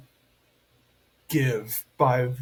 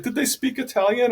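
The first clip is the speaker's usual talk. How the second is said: mom's high question; 9 semitones higher than usual. Retold or imitated. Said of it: "She's like".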